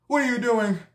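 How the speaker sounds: what are you doing pushed up high, rising anger; the husband's demand escalating on the phone; rising angry demand. Retold or imitated. Angry husband repeats, higher.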